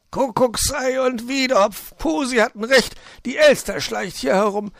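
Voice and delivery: high, loud, agitated fox; alarmed cursing. A narrator's reading. Fox realising the magpie is prowling, loudly alarmed. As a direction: curse in alarm, pitched right up. Hurt fox curses at intruder.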